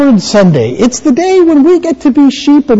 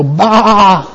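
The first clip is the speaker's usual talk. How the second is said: drawn-out mock bleat; playful imitation of a sheep. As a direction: bleat like one of the flock. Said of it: "hear you guys go".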